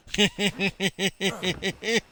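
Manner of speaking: real high-pitched laugh bursting out; giggling fit. Real, not acted. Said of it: "I don't know why I laugh so much".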